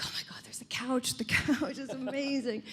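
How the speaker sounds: whispered, hushed awe; awed whisper. Retold or imitated. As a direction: breathe it like a fan spotting a relic. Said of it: "I was like".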